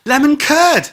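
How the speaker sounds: high, loud exclamation of Lemon curd; delighted outburst at the rhyme; excited realisation. Real, not acted. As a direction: burst out as it clicks.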